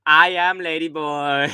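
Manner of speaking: drawn-out hammed-up lady boy line; mock lady boy voice again from the video. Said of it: "the video of the".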